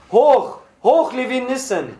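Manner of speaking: high, ringing cheer; rallying shout. A narrator's reading. Prisoners in the crowded cell cheer their leader, shouting. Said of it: "schrien etliche aus der Mitte".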